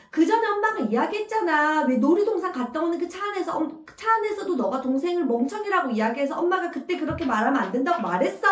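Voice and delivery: a hint of a mother recounting to win the argument; mother retorting. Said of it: "대꾸를 해주고 계신 거예요".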